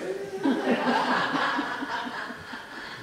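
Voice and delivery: airy laugh after the joke; high breathy laugh. Real, not acted. Preacher laughs at his own cliff joke, airy laugh. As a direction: laugh at the punchline.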